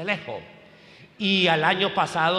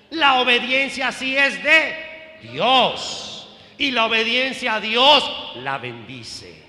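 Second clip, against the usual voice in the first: high, loud pulpit proclamation; forceful sermon voice, punched key words; emphatic preaching; 7 semitones higher, 5 dB louder than usual. Real, not acted. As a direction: drive each phrase home to the congregation.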